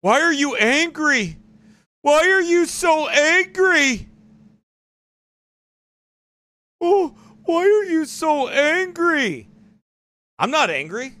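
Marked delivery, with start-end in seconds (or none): 0.0-9.5 high, slow drawn-out taunt; mocking imitation. Preacher mimicking a critic asking why he's angry, mocking.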